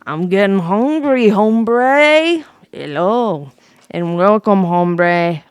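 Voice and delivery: loud, booming hombre voice; Preeny voice. Pretend. Streamer greets chat as Preeny, loud and booming. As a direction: boom it out like a big cartoon character.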